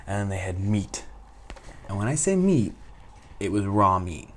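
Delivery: ordinary talk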